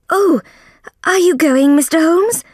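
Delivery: high, loud and alarmed; anxious cry. Acted. Soames cries out as Holmes leaves, alarmed. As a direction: cry out, panicking he'll be left alone. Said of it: "Mister Soames cried".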